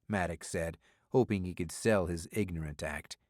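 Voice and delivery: plain reading voice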